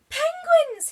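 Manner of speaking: very high, loud squeak. Acted. Penguin spotting other penguins, squeaking high and loud. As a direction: squeak it out with joy. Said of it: "he squeaked".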